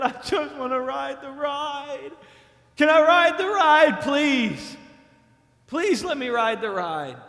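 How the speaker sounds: put-on high, loud begging like a tearful kid; pleading child. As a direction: beg to be let on the ride. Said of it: "started crying".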